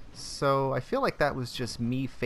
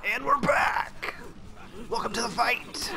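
high, energetic return call; pitched-up arena welcome; hype announcer call; 8 semitones higher than usual. Pretend. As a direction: announce the comeback with gusto. Hyping return to the fight.

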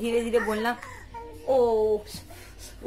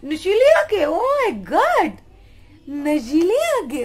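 high, loud delighted cry; high excited squeal; 5 semitones higher, 7 dB louder, much slower than usual. Real, not acted. Mom gushes loudly and high at her baby.

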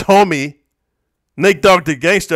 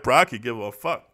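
ordinary talk

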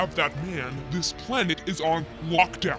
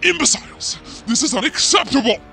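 loud, raised commander's fury; furious outburst; 6 semitones higher, 5 dB louder than usual. Acted. Commander raging as the plan goes wrong, furious outburst. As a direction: explode at useless underlings. Commander rages at failed soldiers.

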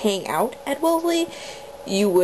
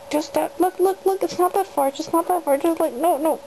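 high pleading voice; 8 semitones higher than usual. Real, not acted. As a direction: beg the bunny to hop down.

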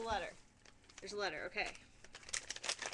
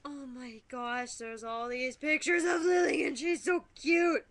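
louder, slow excited gush; delighted exclaim; 8 dB louder than usual. Real, not acted. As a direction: burst out with delight. Seeing pictures of the baby.

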